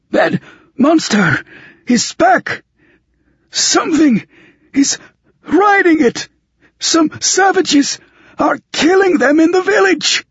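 very high panicked voice, gasping word by word; choked-out gasps. Acted. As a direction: choke it out in breathless, broken bursts. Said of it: "He choked out, in one-word gasps".

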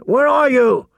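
high, ringing calls; calling out. A narrator's reading. Man searches for his missing wolf, high-pitched shouts. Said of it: "he called again".

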